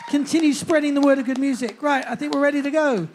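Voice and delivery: loud, high MC build-up; rousing announcer calling for applause; stage introduction. Real, not acted. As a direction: bring the band on with a shout.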